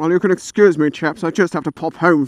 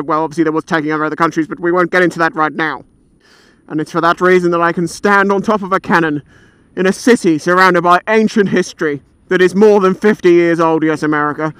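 ordinary talk, nothing marked

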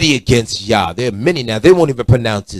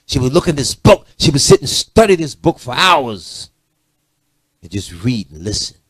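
normal talking voice

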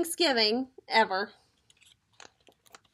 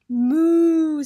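cow's moo; 3 semitones higher, 9 dB louder than usual. A narrator's reading. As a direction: moo loudly like a cow. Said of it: "said the cow".

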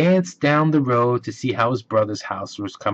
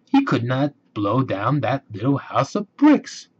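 usual speaking voice

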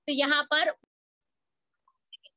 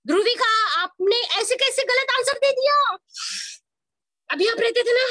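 mock scolding; 7 semitones higher, 4 dB louder than usual. Teacher scolds student for wrong answer.

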